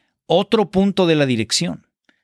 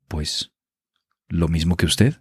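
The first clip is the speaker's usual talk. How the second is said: solemn period-drama actor; 9 semitones lower than usual. Pretend. Mocking period-film actor's reply, low and solemn. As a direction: overplay the gravity of a simple line. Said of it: "todo tiene que ser muy solemne".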